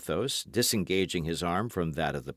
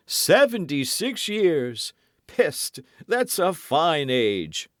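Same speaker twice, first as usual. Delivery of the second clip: lightly voiced swaggering musketeer, raised pitch; Porthos voiced; 9 semitones higher, 4 dB louder than usual. A narrator's reading. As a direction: play Porthos pleading with mock hurt. Dialogue continues.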